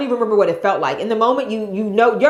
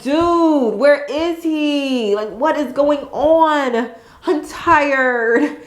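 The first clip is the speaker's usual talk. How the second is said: high, drawn-out mock whine; exasperated whine; 7 semitones higher, much slower than usual. Mom recounts fruitless pushing, high exasperated whine. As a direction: reenact worn-out impatience, stretching the words. Retold impatient whining during pushing.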